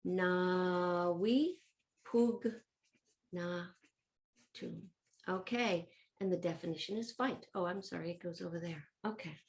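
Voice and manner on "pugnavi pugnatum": drawn-out, syllable by syllable; slow dictation. Shown as a demonstration. Teacher dictates verb forms to pupils writing, very slowly. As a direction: stretch each syllable for someone writing along.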